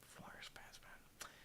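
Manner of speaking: breathy hushed aside; half-joking whisper to chat. Real, not acted. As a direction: drop to a near-silent whisper. Hushed aside about the fans.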